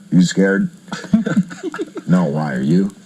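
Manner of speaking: mock-tough guys trading a joint; low, swaggering imitation of stoners. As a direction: play the nervous guys acting brave. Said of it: "everybody sitting there going".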